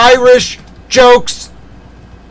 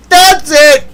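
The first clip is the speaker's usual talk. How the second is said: a much higher, louder "That's it"; mock furious yell; 8 semitones higher, 6 dB louder than usual. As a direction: blow up in mock fury. Hammed-up outburst of mock anger.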